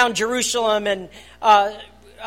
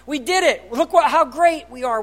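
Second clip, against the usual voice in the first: higher, louder mock brag; mock boasting; 4 semitones higher, 4 dB louder than usual. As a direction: puff up like a proud fool. Mocking human self-praise.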